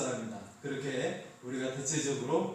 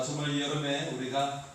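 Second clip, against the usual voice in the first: ordinary talk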